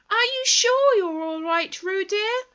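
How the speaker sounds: high, loud, worried motherly call; a mother calling out to her little one; anxious call. Acted. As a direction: Kanga calling to Roo in the stream, anxious. Kanga's voice held.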